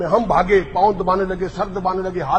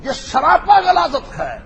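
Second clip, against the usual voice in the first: disgusted outburst; 5 semitones higher than usual. Real, not acted. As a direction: spit the insult out in revulsion. Preacher denouncing Mirza in disgust.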